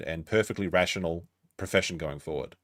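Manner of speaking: usual speaking voice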